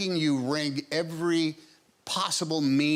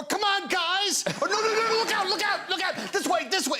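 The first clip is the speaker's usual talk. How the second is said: high, urgent cartoon cowboy yelps, words tumbling out; Woody in a panic. Actor shows a talk show how Woody always sounds, high and clenched. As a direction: push it high and clenched, urgently warning everyone. Acting out Woody's tense lines.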